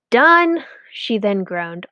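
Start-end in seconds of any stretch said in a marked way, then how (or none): 0.1-0.6 high, loud declaration; triumphant done. A narrator's reading. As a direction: announce it proudly.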